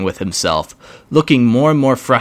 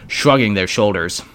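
plain reading voice

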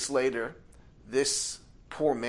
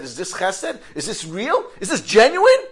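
building from raised to a much higher, louder outcry; each question angrier than the last; agitated questioning; 10 semitones higher, 14 dB louder than usual. Retold or imitated. Owner questioning himself, worked up.

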